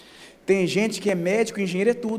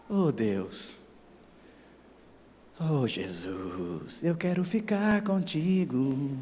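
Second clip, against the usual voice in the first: tender John; 5 dB quieter, much slower than usual. Retold or imitated. Pastor voices John longing for Jesus, soft and tender. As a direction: sigh it to God lovingly. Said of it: "E João?".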